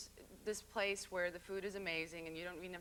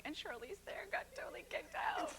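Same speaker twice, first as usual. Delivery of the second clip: whispered high gossip; gossipy whisper; 13 semitones higher than usual. Pretend. A diner whispering gossip about her at a dinner table. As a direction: lean in and whisper it like juicy gossip.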